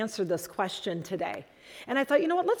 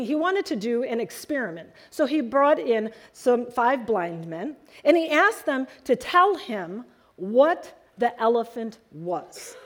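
ordinary delivery

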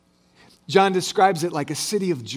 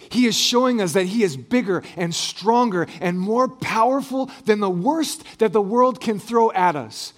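ordinary delivery

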